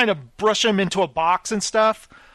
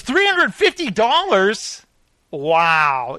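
mock disbelief; slower than usual. Cheap boss hearing the bee quote, mock disbelief. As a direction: repeat the price as if outrageous. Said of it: "He goes".

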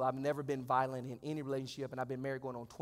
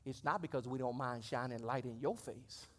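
ordinary talk

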